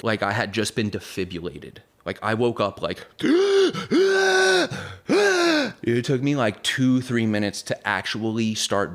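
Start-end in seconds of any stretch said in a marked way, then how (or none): 3.1-5.8 loud, high sharp inhale for air; huge gasp. Retold or imitated. Narrator reenacts waking up as if revived, loud gasp. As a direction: gasp like coming back to life.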